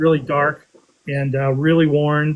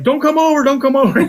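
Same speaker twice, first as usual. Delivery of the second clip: high, pleading call; urgent call; 10 semitones higher, 5 dB louder than usual. Retold or imitated. Embarrassed finder calling off the club, high and urgent. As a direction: wave them off in a panic. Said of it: "trying to get on the walkie-talkie".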